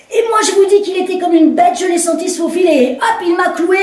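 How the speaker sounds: woman protesting to the commissaire; indignant woman. Acted. Woman argues her point.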